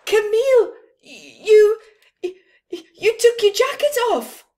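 high, loud stuttering exclamation; stammering in shock. Acted. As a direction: stammer it out in disbelief. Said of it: "they all exclaimed".

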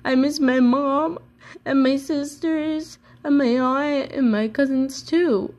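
lightly voiced tearful child; tearful young elephant. A narrator's reading. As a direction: let a little calf's grief pitch the voice up. Ruby crying for her family.

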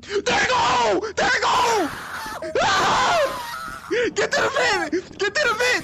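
high frantic yelling; panicked shouting. Real, not acted. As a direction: shout while running for your life. Monster appears again, fleeing.

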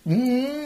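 high sustained hummed note mimicking a guitar; imitated guitar note. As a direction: hum it like a bent guitar string. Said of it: "plugged in and went".

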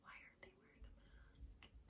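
hushed, scandalized aside; mock-outraged whispering; gossipy whisper. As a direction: whisper behind someone's back to a coworker. Acting gossip to a coworker behind back.

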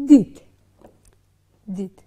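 دید said clearly and firmly, twice; sounding out. Shown as a demonstration. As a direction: model the word دید for learners. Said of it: "کلمه شد". (تلفظ واضح کلمه دید)

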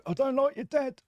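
a hammed-up, high-pitched repeat; high mock squeal. Mock repeat to game character.

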